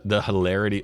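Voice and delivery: ordinary delivery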